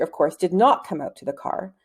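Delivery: usual speaking voice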